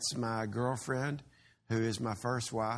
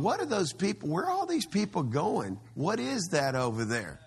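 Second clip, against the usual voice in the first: curious passers-by; 6 semitones higher than usual. Retold or imitated. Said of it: "people driving by going".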